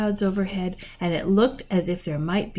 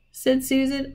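ordinary delivery

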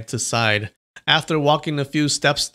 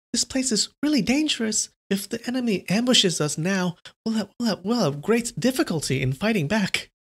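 put-on much higher, worried girl; a touch of fear in the voice; nervous disciple; 8 semitones higher than usual. Frightened disciple urging retreat, mildly anxious.

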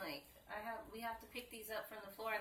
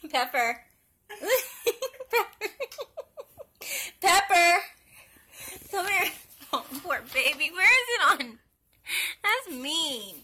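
talking to a pet; 7 semitones higher, 14 dB louder than usual. Real, not acted. Calling and cooing to the dog.

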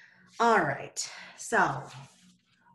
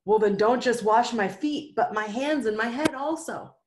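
eager Peter; 5 semitones higher, 7 dB louder than usual. Acted.

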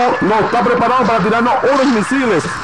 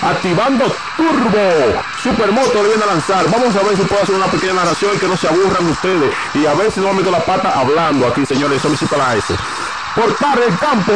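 ordinary talk

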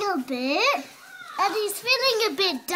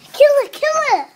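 urgent; 5 semitones higher, 7 dB louder, faster than usual. Real, not acted. Urging to kill the insect.

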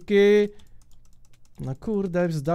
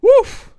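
relieved whoof; 13 dB louder than usual. Real, not acted. Relief after winning.

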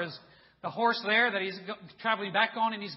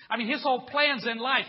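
ordinary talk

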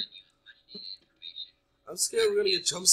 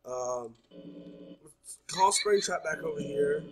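ordinary talk

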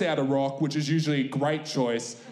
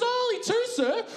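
high, small apologetic voice; a meek teen worker answering; timid server. Retold or imitated. KFC girl to dad, high timid reply. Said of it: "She goes".

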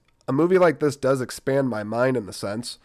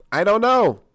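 sudden higher, louder exclaim; 9 semitones higher, 7 dB louder than usual. Real, not acted. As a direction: burst out with it. Tired mumble then repeat.

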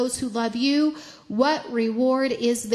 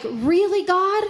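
higher-pitched, puzzled appeal to God; questioning God; 6 semitones higher, 4 dB louder, slower than usual. Retold or imitated. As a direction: ask God in honest disbelief, not doubt. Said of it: "but in a questioning way".

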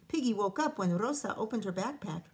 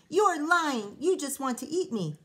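accusing; 5 semitones higher, 6 dB louder than usual. Acted. Piggy accuses Rosa.

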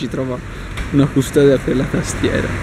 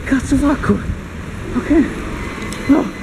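much higher, squeaky and comic; 10 semitones higher than usual. Acted. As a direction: squeak it out in a silly voice. Squeaky high voice while running.

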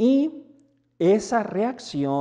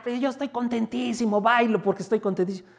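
fast, higher mock outburst of glee; hammed-up happy blurt, quick and pitched up; mock shouting joy; 7 semitones higher, much faster than usual. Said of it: "y me pongo a gritar". (grito fingido de alegría, rápido y agudo)

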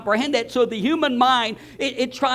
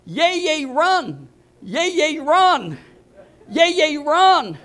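higher-pitched mock yell, drawn-out "run"; imitated child's yelling; 6 semitones higher, slower than usual. Granddaughter summoning grandpa from his office, imitated yelling. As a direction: call out like an insistent little granddaughter. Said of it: "she starts yelling at me".

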